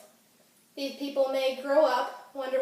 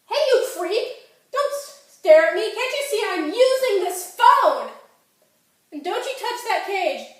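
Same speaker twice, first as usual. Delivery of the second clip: high, loud scolding of a staring stranger; indignant, raised-pitch outburst; irate yelling; 7 semitones higher, 6 dB louder than usual. Acted. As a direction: yell at a creep to back off. Snaps at staring bystander.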